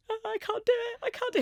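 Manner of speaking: high, pleading cry of I can't do it; begging in panic, voice jumping up; panicky plea. Retold or imitated. To husband before the spinal needle, panicked high plea.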